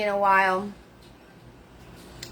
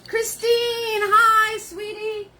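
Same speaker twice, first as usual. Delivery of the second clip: high delighted greeting; light up at seeing her; high, delighted hello to a viewer; excited hello; 11 semitones higher, 3 dB louder, slower than usual. Real, not acted. Spotting a viewer in chat.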